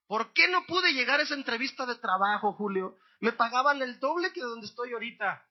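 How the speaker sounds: someone griping over a missed job interview, acted-out complaint; mock grumbling. Churchgoer complains to Julio about the flat tire, mock grumbling. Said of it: "Y reniegas y reniegas".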